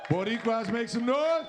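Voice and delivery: rally the Boricuas; hyping the crowd. Real, not acted. Host to the crowd, loud high hype.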